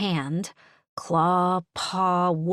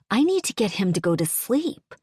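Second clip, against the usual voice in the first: ordinary delivery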